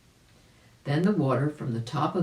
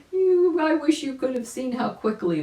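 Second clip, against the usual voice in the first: laughing excitedly; 10 semitones higher, 5 dB louder than usual. A narrator's reading.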